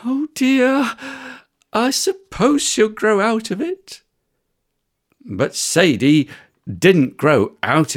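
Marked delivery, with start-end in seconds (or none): 0.0-4.0 worried mum. Acted. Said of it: "Mum thought".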